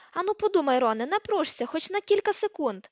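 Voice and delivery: high-pitched, loud sarcastic urging; biting sarcasm. A narrator's reading. Hermione taunting Ron, bitingly sarcastic. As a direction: taunt him to think. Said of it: "з ядучим сарказмом заволала".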